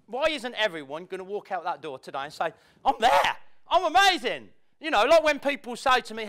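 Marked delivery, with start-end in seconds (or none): 2.8-4.5 I'm amazing said louder and higher, proud; sincere proud I'm amazing; confident claim. Shown as a demonstration. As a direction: own it out loud. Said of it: "No, I don't mean like that".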